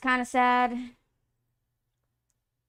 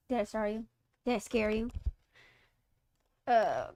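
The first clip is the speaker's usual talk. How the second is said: ordinary talk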